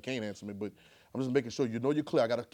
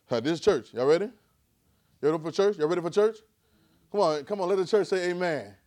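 mock call and response; 6 dB louder than usual. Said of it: "I like church like this".

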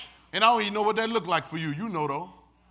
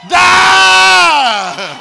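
sudden full-voice shout, much higher; shouted Die; 11 semitones higher, 19 dB louder than usual. Shown as a demonstration. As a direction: scream the word out to the room. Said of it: "We're gonna do it".

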